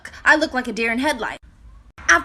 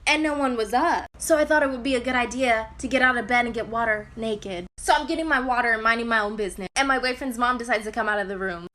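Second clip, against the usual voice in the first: ordinary talk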